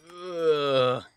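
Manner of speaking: loud annoyed ugh; a loud, fed-up ugh; frustrated groan. Real, not acted. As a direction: groan at the bad luck. Elevator villagers reset again.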